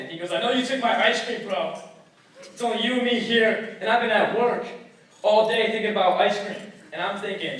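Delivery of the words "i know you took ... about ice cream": loud, aggressive mock complaint; accusing. Roommate blames guest for missing ice cream, heated. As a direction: accuse him, voice raised. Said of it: "He's out to kill".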